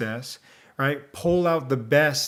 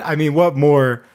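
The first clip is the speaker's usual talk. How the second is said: usual speaking voice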